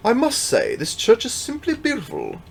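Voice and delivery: high, put-on posh accent for the priest. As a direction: play the priest with a fancy accent. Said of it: "Don't know why I decided to give you an accent".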